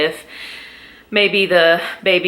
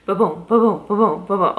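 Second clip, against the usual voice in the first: steady heartbeat sound; 2 semitones higher than usual. Shown as a demonstration. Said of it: "a heartbeat's gonna be ba-boom".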